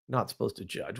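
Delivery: low, muttered mock of an objector; dismissive low mutter; scornful echo. Repeats objector's line with scorn.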